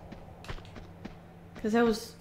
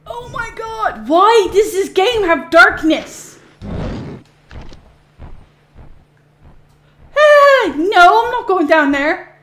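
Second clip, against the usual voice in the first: high, much louder panicked cries; frightened outcry; 8 semitones higher, 11 dB louder, slower than usual. Real, not acted. Gamer spooked by darkness, high panicked shouting. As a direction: yelp in real fright at the dark. Scared in dark game area.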